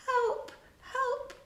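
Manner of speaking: high, thin cry for help; a faint desperate call; feeble cry. A narrator's reading. Wendy cries out feebly for help, high and thin. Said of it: "a feeble cry".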